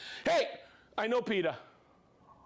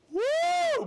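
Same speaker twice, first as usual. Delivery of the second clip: a high, loud cheer of "Woo"; excited whoop; 7 dB louder than usual. Real, not acted. Host whooping at a viewer's hometown. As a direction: whoop it out with real delight.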